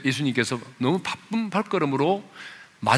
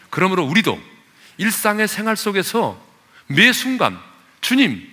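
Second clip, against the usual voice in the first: high, loud, sustained exhortation; full-force preaching; 9 semitones higher, 6 dB louder than usual. Acted. Preacher urging congregation to pray in every hardship, fervent loud preaching. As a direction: build in volume as you urge them to pray.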